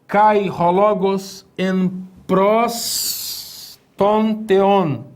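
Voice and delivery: Kai ho logos en pros ton theon read very slowly and carefully; solemn slow reading of Kai ho logos en pros ton theon; slow Greek reading. Shown as a demonstration. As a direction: read the Greek line word by word. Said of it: "Esse texto diz assim".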